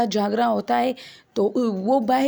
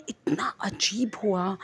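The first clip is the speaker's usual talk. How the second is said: hushed, breathless whisper of fear; scared whisper; 6 dB quieter, slower than usual. Retold or imitated. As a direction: whisper it as if afraid to be heard. Sister recounting scary night. (ڈری ہوئی سرگوشی)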